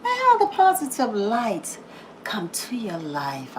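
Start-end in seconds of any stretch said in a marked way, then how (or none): 2.2-3.6 soft whispered blessing. Real, not acted. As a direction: breathe the words as a prayer.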